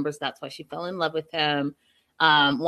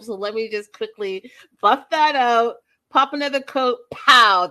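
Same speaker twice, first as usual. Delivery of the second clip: louder, higher, punchy pops; playful quick fix; 4 semitones higher, 8 dB louder than usual. Pretend. Showing how she could fix her nail, playful and punchy. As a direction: snap it out like a fast salon trick.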